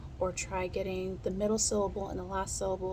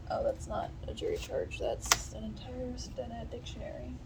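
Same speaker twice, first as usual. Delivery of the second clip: softer whisper to herself; muttered whisper to herself; whispered aside; 4 semitones higher, 6 dB quieter than usual. Real, not acted. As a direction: whisper it, surprised at the wrong book. Aside while grabbing material.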